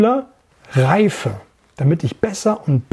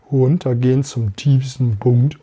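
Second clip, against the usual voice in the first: lowest pitch; 4 semitones lower than usual. Shown as a demonstration. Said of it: "wie hoch und wie tief du kommen kannst".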